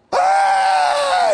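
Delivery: high, loud mock scream of alarm; mother's shriek. Mother sees broken arm.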